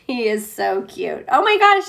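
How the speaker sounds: ordinary delivery, nothing marked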